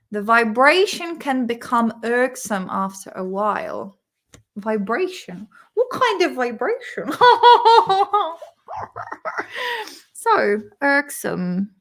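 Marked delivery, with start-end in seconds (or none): 5.7-8.4 real laugh breaking in, pitch raised; higher, giggly question; amused. Real, not acted. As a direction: ask it while cracking up. Cracks up at innuendo.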